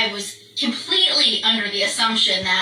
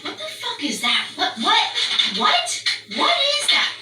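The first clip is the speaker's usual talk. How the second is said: building from puzzled to high-pitched shock; alarmed disbelief; 11 semitones higher than usual. Retold or imitated. Out on the deck seeing what the dog barks at, rising alarm. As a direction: climb higher with each startled what. Spotting something frightening at the fence.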